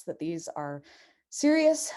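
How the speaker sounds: ordinary delivery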